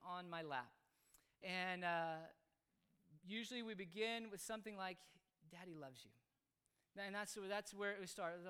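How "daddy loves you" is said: soft, low fatherly voice; tender reassurance. Retold or imitated. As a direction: gently reassure a small child. Gently reassuring child before discipline.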